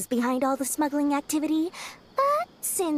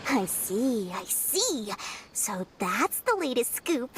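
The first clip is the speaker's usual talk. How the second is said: ordinary delivery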